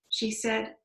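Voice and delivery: ordinary delivery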